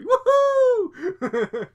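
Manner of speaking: high, full-volume whoop, re-enacted; screaming at monitor. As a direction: let out a long excited cheer at the screen. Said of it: "I'm screaming literally at the monitor".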